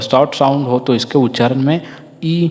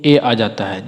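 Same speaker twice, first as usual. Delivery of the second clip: ordinary talk, nothing marked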